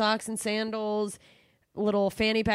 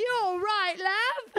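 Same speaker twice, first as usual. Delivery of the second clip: much higher mock British voice; Eleanor Conway accent; 10 semitones higher, much slower than usual. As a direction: nail the friend's British accent. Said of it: "that's so her accent".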